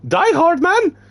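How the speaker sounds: very high, loud Die Hard Man?; a rough first take of Die Hard Man? in character; first try character voice. Acted. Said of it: "Wait, wait".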